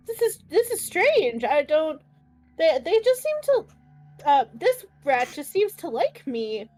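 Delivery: ordinary talk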